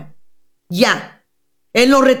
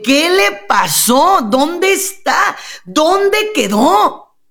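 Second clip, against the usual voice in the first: high, drawn-out rhetorical questions; dramatic questioning; 6 semitones higher, much slower than usual. Acted. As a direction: plead slowly for the missing one, theatrically. Mock lament for the lost sweet woman.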